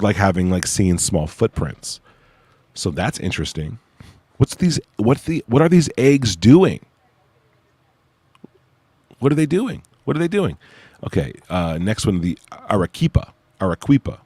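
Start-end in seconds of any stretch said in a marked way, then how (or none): 4.4-10.6 high, incredulous disbelief; puzzled and amazed; baffled. Real, not acted. Baffled by egg-shaped UFO cases.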